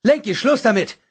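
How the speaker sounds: high, loud command; stern shout; sharp rebuke. A narrator's reading. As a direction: snap at him to stop.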